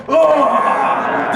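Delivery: loud laughing disgusted groan; big groan. Shown as a demonstration. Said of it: "I make a big groan before".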